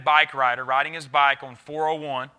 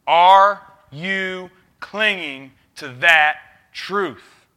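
"Are you clinging to that truth?" stretched very slowly and louder, each word weighted; slow preaching question; 3 semitones higher, 8 dB louder, much slower than usual. Real, not acted. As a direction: lean hard on every word, drawn out for the congregation. Pastor challenging the church.

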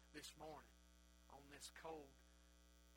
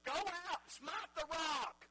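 loud, high, slowing acted command; God commanding; 11 semitones higher, 9 dB louder, much slower than usual. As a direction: thunder the order out as a preacher playing God. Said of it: "And he tells Moses".

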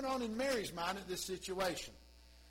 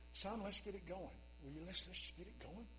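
softer, muttered urging; under her breath; 8 dB quieter than usual. Pretend. Mary urging Jesus under her breath, softer. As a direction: mutter it so only he hears. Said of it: "saying under breath".

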